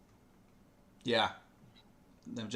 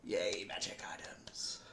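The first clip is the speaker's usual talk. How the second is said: whispered cheer; 3 semitones lower, 9 dB quieter than usual. Real, not acted. GM whispers excitement.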